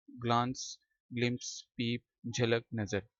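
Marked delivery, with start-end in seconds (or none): 0.1-0.8 "ग्लांस" with a long open "aa"; British pronunciation. Shown as a demonstration. Teacher repeating the word for students, British "ग्लांस" with long "aa". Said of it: "British accent में इसको पढ़ेंगे ग्लांस".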